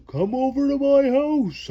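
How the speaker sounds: high call to friends. Acted. Girl calling her friends over to her house, calling out. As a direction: call them over across the yard. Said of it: "I say".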